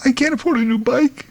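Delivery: put-on high-pitched little kid voice; distressed kid. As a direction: whine it way up high like a child. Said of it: "whose kid has his bike run over".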